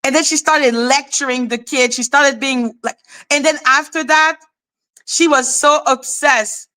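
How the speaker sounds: ordinary talk, nothing marked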